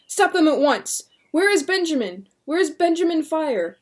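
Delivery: high loud urgent demands; frantic demands. Acted. William demanding the deputy halt it, frantic loud demand. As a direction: demand answers now.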